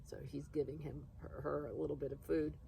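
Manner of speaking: ordinary delivery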